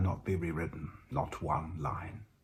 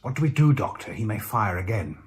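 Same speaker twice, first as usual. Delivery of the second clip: alarmed plea; 3 semitones higher, 7 dB louder than usual. A narrator's reading. Miles panicking amid gunfire.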